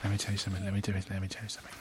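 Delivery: quick, low, dwindling repetition; fading repeated catchphrase. Acted. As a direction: let it sink away under your breath. Repeats a catchphrase, dropping away.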